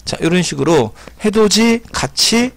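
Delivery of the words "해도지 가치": "해돋이, 같이" said [해도지, 가치]; palatalized reading. Shown as a demonstration. Teacher recapping how they read, "해돋이, 같이" as [해도지, 가치]. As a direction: demonstrate "해돋이, 같이" with j and ch. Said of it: "이렇게 읽힙니다".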